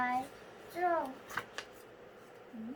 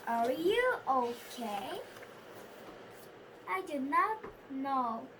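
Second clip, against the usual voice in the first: usual speaking voice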